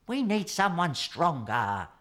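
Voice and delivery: a tired penguin giving up a little; sighing. A narrator's reading. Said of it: "he sighed".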